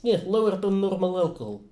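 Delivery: put-on joking doctor with a Russian accent; deadpan Russian medic prescribing vodka. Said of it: "I like my Russian accent".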